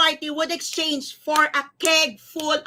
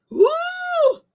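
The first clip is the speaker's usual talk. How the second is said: excited whoop; 5 dB louder than usual. Real, not acted. Reveal after drum roll.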